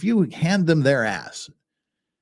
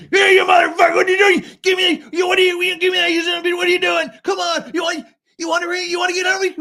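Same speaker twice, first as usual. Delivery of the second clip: much higher, much louder furious yelling at a stranger; road rage shout; 5 dB louder, faster than usual. Retold or imitated. Angry driver who rolled up, shouting abuse. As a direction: scream it like a hothead jumping out of his car. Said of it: "they just get out and they just start".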